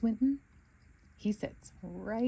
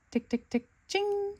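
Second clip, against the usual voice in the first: typewriter noises; 13 semitones higher than usual. Acted. Typing on pretend typewriter.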